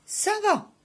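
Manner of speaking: high, sudden inner protest; exasperated inner retort. Acted. Patient silently answering the kiné's complaints, exasperated. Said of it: "je me disais".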